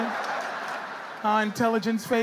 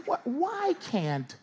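slow mock plea; 8 semitones lower, 5 dB quieter, much slower than usual.

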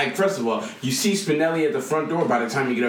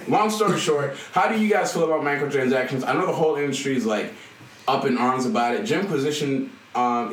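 ordinary talk, nothing marked

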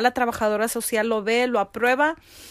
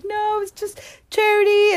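overwhelmed; 11 semitones higher, 3 dB louder than usual. Retold or imitated. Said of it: "I couldn't even talk".

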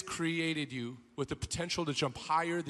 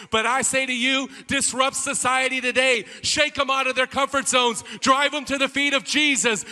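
rising, loud preaching push; full-force preaching; 5 semitones higher, 8 dB louder than usual. Acted. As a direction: drive the call home like a revival preacher. Preacher exhorting the congregation.

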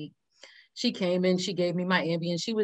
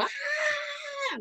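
high mock shriek of an aunt; aunt's hammed-up panicked squeal; 6 dB quieter than usual. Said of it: "she's freaking out".

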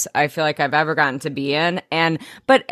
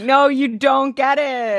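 insistent; 7 semitones higher than usual. Retold or imitated. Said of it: "And I'm like".

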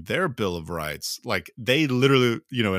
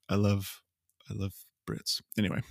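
ordinary talk

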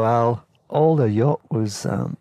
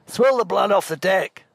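bossy order; 8 semitones higher than usual. Retold or imitated. Al barks order at crew.

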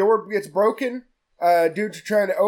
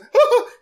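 much higher, louder cackle; villain laugh; 6 dB louder than usual. Retold or imitated. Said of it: "she goes".

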